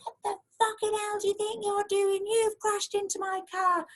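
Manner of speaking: high-pitched furious mock rant; imitating angry woman. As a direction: ham up the ranting stranger at the window. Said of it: "this lady appeared at my window going".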